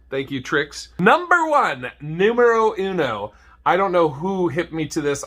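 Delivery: usual speaking voice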